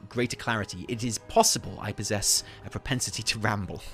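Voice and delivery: dramatic in-character lore reading; musing, rambling scholar. Acted. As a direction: puzzle it out theatrically, then note it drily.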